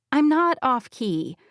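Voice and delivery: plain reading voice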